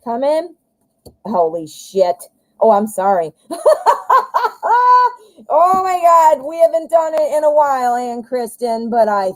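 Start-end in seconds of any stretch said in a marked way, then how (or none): 3.4-5.1 high burst of shocked laughter; real surprised laugh. Real, not acted. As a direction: laugh out loud in disbelief.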